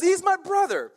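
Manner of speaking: very high, loud mock amazement; hammed-up excited cry; acted exclamation. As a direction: proclaim it like an overjoyed king. Repeats Ahab's exclamation.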